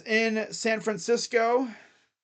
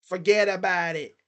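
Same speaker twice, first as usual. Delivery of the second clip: a lightly put-on New York mobster accent, half-joking; hamming up a loose New York mob accent for the Giants QB; mock mobster accent; 3 dB louder than usual. Hyping Tommy Cutlets to his listeners, in a mild mock New York mobster accent. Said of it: "I don't even know what kind of accent that is".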